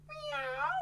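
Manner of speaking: very high meow; cat meow. Shown as a demonstration. Magician giving kids a sound to guess, high cat meow. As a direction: mew like a cat. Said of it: "The animal goes".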